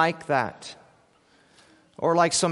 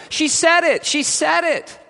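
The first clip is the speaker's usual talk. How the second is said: much higher, loud excited shout; yelled out; 11 semitones higher, 5 dB louder than usual. Acted. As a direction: shout it in triumph. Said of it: "several from shore yelled out".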